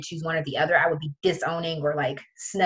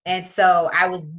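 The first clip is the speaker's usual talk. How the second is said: usual speaking voice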